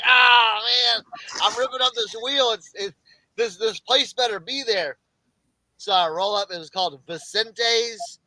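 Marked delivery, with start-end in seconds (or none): 0.1-5.0 higher, louder exasperated shout; frustrated yell. Retold or imitated. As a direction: cry out at the wrecked wheel. Tire ripping while driving.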